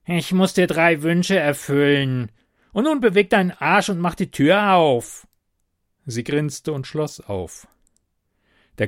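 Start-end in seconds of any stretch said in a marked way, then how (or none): 0.1-5.3 higher, louder grumpy goblin voice; growling goblin. A narrator's reading. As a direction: growl it out, irritated little goblin. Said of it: "Das Wesen knurrte".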